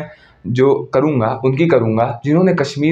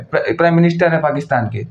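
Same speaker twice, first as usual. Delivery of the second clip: usual speaking voice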